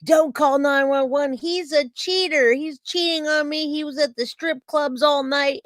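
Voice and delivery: imitating an irate woman, high-pitched; retold angry yelling. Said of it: "literally cussing and yelling at me".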